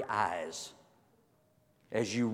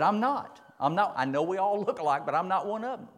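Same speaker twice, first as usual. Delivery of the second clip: Peter's denial; 8 semitones higher than usual. Acted. Peter denies again.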